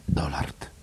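very soft, voiceless whisper. A narrator's reading. Drinker repeats the name to Miss Kennedy, whispering. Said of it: "wyszeptał kufel".

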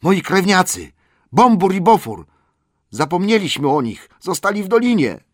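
loud, high, panicked cry; shouting. A narrator's reading. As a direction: shout it out in alarm. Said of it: "gdy Bifur krzyknął".

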